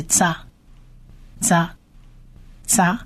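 "扎" ending in an open a, repeated with pauses; syllable with a vowel. Shown as a demonstration. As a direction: sound out "扎" as a separate syllable each time. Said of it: "就是发啊的音". (分开重复示范“扎”，元音发“啊”)

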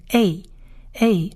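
a said slowly as "ay"; isolated, drawn-out "ay" for a; vowel-sound demo. Shown as a demonstration. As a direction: sound out a as ay, pausing between repeats. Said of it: "像眼睛念".

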